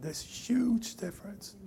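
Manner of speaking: slow emphatic whisper; hushed slow emphasis. Real, not acted. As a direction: whisper it slowly for weight. Preacher whispers key point.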